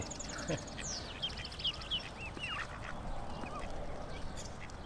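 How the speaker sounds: long, soft wheezing laugh; wheezy chuckle at his own joke; breathy laugh. Real, not acted.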